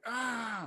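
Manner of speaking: a weak high-pitched little ah, imitating the men; timid high ah. Man at retreat imitated, timid high ah. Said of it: "And they're like".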